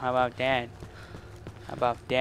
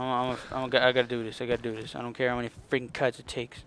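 normal talking voice

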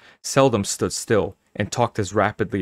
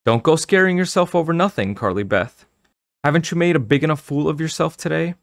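higher-pitched, insistent telling-off; self-scolding; 8 semitones higher, 3 dB louder than usual. A narrator's reading. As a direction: scold yourself firmly, pitched up. Girl scolds herself in thought.

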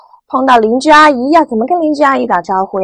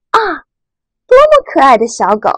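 admiring exclamation; 4 semitones higher, 4 dB louder than usual. Shown as a demonstration. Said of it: "发出了一声赞叹". (赞叹的语气，慢慢读)

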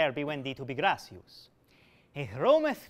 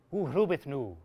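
ordinary delivery

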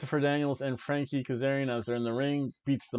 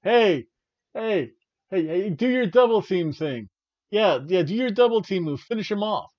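louder, higher bossy yelling; mock shouting; 6 semitones higher, 5 dB louder than usual. Manager shouting orders at his tag team, mock yell. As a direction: bark orders at your hired team. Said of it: "M J F's shouting at them".

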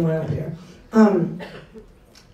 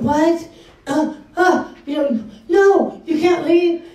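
high, louder, startled protest; panicked; 8 semitones higher, 4 dB louder, slower than usual. Retold or imitated. Patient hears her doctor is leaving, panicked. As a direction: blurt it out in alarm. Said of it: "I panicked".

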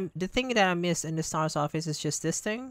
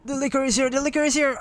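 panicked high-pitched yell; scared yelling; 9 semitones higher, 6 dB louder, much faster than usual. Real, not acted. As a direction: shout it in real panic. Zombie chase, panicked yelling.

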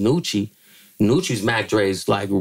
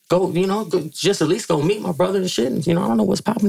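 much higher, urging voice; imitating himself pitching; 10 semitones higher, faster than usual. Young editor urging [name] to the station, high urging. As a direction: coax him to meet your brother. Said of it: "that's when I tell him".

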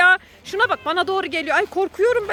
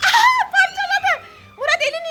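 shocked cry; 9 semitones higher than usual. Real, not acted. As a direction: shriek it out, half laughing. Cat tears him apart. (şaşkın, gülerek çığlık)

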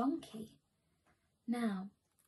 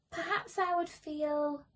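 plain reading voice

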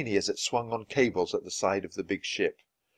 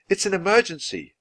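urgent; 10 semitones higher, 3 dB louder than usual. Acted. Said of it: "Annie shouted".

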